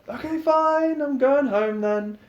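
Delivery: high, loud exasperated give-up. Acted. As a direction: throw up your hands loudly. Lux giving in, exasperated.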